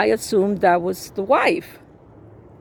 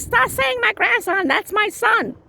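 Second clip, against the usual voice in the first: high-pitched mimicry of an insulted father; wounded-pride squawk, imitated; offended mock protest; 12 semitones higher than usual. Retold quarrel, man's offended retort.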